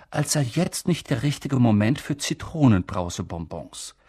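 ordinary delivery

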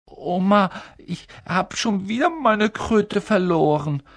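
put-on high wailing complaint of a boy; a boy lamenting in a high voice; plaintive whine. A narrator's reading. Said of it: "hörte ihn klagen".

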